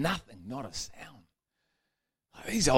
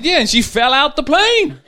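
put-on old husband explaining; 13 semitones higher, 7 dB louder than usual. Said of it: "He said".

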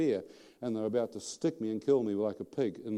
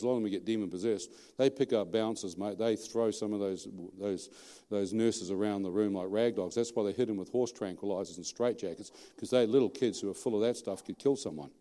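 ordinary talk

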